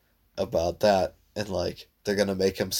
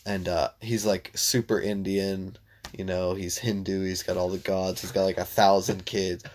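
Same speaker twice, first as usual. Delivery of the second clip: ordinary delivery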